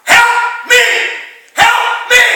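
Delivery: high, loud, pleading shout; desperate cry for help. Acted. Father begs Jesus to heal his son, shouting high. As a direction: cry out to be rescued, straining. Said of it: "I'm crying for help".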